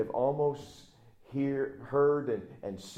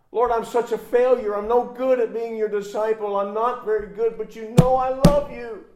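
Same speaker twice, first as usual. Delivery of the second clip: rising, louder and higher pleading, near tears; tearful confession; 9 semitones higher, 11 dB louder than usual. Acted. Peter to Jesus, confessing his failure, tearful and louder. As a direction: pour out the guilt. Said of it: "He begins to weep".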